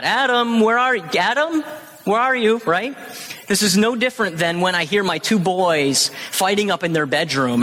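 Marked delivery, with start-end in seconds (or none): 0.0-2.6 high, calling out as if he cannot find him; hammed-up high searching call; mock searching call. Shown as a demonstration. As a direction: call out like a clueless seeker looking around.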